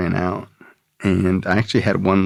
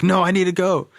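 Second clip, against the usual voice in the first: insistent; 11 semitones higher than usual. Retold or imitated. Insisting to mom.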